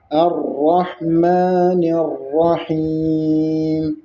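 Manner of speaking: slow recitation stopping on الرحیم with a still mim; chanted Quran, final mim with sukun. Shown as a demonstration. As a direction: stop on the mim of الرحیم without a vowel. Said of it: "میم پر آپ وقف کر کے ساکن پڑھیں گے".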